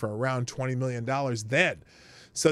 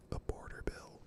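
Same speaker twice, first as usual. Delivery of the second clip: conspiratorial whispered aside; 16 dB quieter than usual. Real, not acted. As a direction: whisper it under your breath. Aside about bill name.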